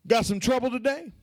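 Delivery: ordinary talk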